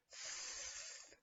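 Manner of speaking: a soft unvoiced th, tongue between the teeth; th sound. Shown as a demonstration. Teacher showing how to make th. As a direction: breathe out th through the teeth. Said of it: "you hear".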